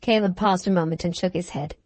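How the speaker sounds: ordinary delivery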